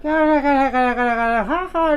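high cackle, mimicking his wife; imitating a cackling laugh. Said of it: "うちのが大笑いするんじゃけ".

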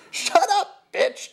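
loud, very high furious scream. A narrator's reading. Jeff silencing the sniper's correction, screaming. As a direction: scream it to cut someone off. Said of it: "Jeff screamed at me".

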